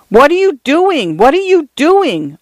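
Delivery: narrated outcry of alarm; alarmed cry. A narrator's reading. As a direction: cry it out in rising alarm, voice swooping high. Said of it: "Arthur cried".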